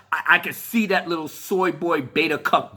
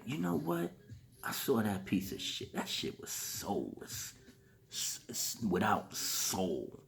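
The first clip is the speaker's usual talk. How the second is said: hushed near-whisper, much softer and lower; private whisper; 12 semitones lower, 17 dB quieter, slower than usual. Retold or imitated. Voicing George Lucas privately calling the film soulless, whispered. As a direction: whisper it like a secret verdict. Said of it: "say privately".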